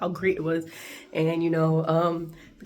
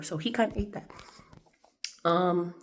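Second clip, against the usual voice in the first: normal talking voice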